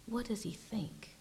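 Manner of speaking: whispered question. Acted. Preacher asking the congregation what God thinks, whispered.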